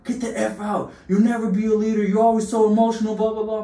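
high, loud put-on rant from a coach; mock coach berating. Coach telling Willie he'll never lead, loud mock rant. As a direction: ham up the coach tearing into him.

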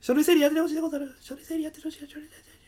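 fading out as he walks off; fading away. Acted. Ninja leaves, line fades away.

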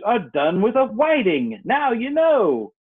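usual speaking voice

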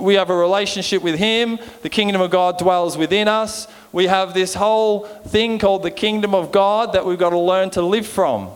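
ordinary delivery

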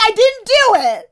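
very high, loud, drawn-out protest; hammed-up confession to the therapist; mock wail. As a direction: wail it comically, stretching the words. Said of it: "I'm like".

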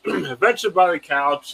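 ordinary talk, nothing marked

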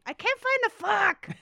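high, slow, very loud wail; imitated child's scream. Parent imitating a child who cannot find a truck, screaming. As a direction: holler it like a frustrated kid, imitating. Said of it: "at the dining room table screaming".

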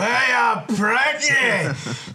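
put-on loud, high obnoxious drunk shout; hammed-up drunken heckle; mock drunk yell. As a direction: bellow like a rowdy drunk in a pub. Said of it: "the most loud, obnoxious, fucking drunk guy".

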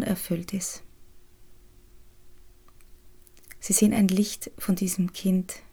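soothing spa whisper. Acted. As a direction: whisper them gently into calm. Leads the inner child meditation.

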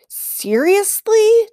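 high, drawn-out disbelieving "seriously"; exasperated. Acted. Boy fed up with the bear following them, high and slow.

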